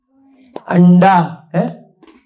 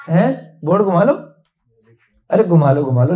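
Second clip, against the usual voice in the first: normal talking voice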